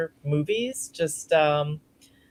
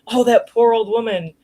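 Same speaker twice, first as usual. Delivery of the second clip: higher-pitched mock lament; selfish son-in-law; 5 semitones higher, 5 dB louder than usual. Son-in-law hearing the old lady is dying, mock selfish lament. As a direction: lightly imitate self-centred pity. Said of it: "he's totally selfish".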